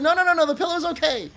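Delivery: high, fast flustered no-no-no; nervous denial. Retold or imitated. Reassuring the shop lady while hiding his panic, fast flustered denial. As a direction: hurry to stop her worrying. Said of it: "I said".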